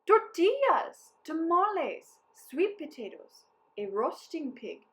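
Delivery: high, loud, slow list building down; excited cry. A narrator's reading. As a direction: call out the treats eagerly. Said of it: "cried the soldiers".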